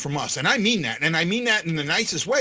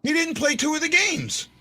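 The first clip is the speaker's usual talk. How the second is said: higher-pitched, heated contradiction; insistent objection; 9 semitones higher than usual. Real, not acted. Cohost disputing the goalie's games, insistent. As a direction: push back hard, pitch jumping up.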